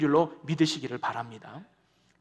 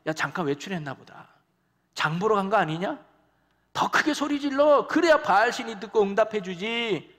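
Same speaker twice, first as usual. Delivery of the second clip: sarcastic taunt; 3 semitones higher than usual. Retold or imitated. Elijah mocking the prophets of Baal, sarcastic jeer. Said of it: "뭐라고 비꼬느냐".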